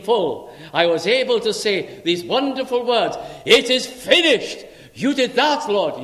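loud, high, rising proclamation; full-force preaching. Acted. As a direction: preach it to the back of the hall. Building to "It is finished.

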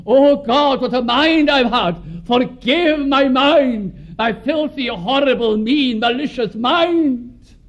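ashamed sinner crying out to God, high and slow; anguished prayer. Acted. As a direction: plead with God, stretching each word. Acted prayer of a shamed soul.

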